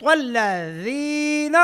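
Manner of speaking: الذین very slow, th for ذ; correct recitation. Shown as a demonstration. Teacher giving the right answer, chanted الذین with th. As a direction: chant الذین with a long held ee. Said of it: "صحیح کیا ہے؟".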